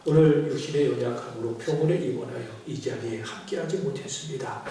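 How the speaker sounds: earnest pulpit prayer, loud at the peaks. Acted. Pastor praying for sick church members, earnest prayer. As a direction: pray over the sick members.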